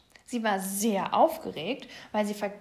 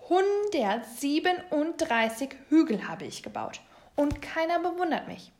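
higher, louder mild boast; proud; 6 semitones higher, 4 dB louder than usual. A narrator's reading. Mole pointing at his many hills, proud. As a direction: show off your hard work, a little aggrieved. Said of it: "deutete stolz auf die Wiese".

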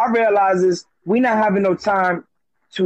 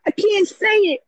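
put-on high, whimpering child voice; crying little boy; 13 semitones higher than usual. Father imitating his crying son, high and whimpering. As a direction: play the upset little son. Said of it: "He said".

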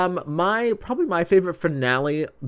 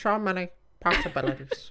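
Mandy Patinkin impression; 6 semitones higher, 5 dB quieter than usual. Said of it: "My name is Mandy Patinkin".

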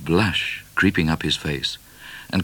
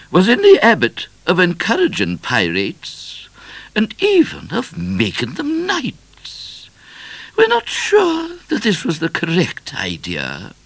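disapproving queen; 6 dB louder than usual. A narrator's reading. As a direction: voice a grand queen passing judgement.

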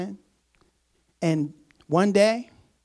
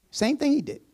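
normal talking voice

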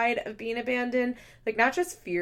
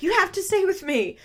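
high, loud pleading outburst; desperate mock cry; 10 semitones higher, 7 dB louder than usual. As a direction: beg loudly in a high voice, imitating someone desperate. Imagined desperate plea while clawing.

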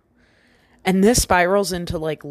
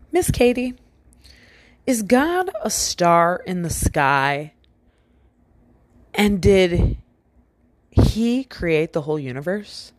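lightly voiced small boy, higher on his opening words; a hint of a curious seven-year-old; child's voice; 3 semitones higher than usual. Seven-year-old asks Katie about God, lightly imitated child voice. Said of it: "he goes".

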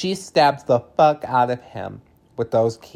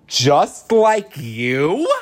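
loud, drawn-out words with a soaring rise; mock incredulity; 2 semitones higher, 6 dB louder, much slower than usual. Host retells Jake comparing himself to Ellie, mock incredulity. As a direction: stretch it into a disbelieving question. Mocking his comparison of traumas.

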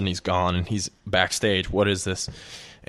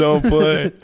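higher and louder, laughing through it; laughing repeat; 7 semitones higher, 6 dB louder than usual. Real, not acted. Friends teasing about his face, laughing. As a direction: laugh as you say it again. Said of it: "You were like".

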